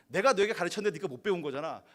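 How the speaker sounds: loud, reproachful teacher snapping back; teacher loudly blaming the student; blaming retort. Acted.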